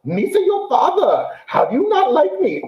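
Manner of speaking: high, mocking alien sidekick voice; Jar Jar Binks. Host mocking the Hawaiian dad's accent, Jar Jar impression. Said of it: "I keep waiting for him to say".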